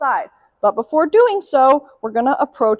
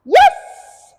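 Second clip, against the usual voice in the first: very high, loud yes with a long S; high marker yes, hissed S; 8 dB louder than usual. Real, not acted. Trainer marking the dog, high yes with a stressed S. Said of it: "Really emphasize the S".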